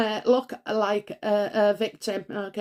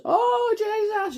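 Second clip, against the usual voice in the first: very high, drawn-out exasperated Oh, Jesus; high mock-despairing Oh, Jesus; 10 semitones higher, slower than usual. Real, not acted. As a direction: throw up your hands in despair. Exasperated at Meghan's meddling.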